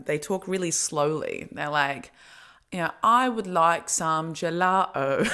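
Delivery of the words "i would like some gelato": slow, drawn-out mock British accent; terrible British accent. Mocking how the English talk, slow British accent. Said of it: "That's a terrible accent".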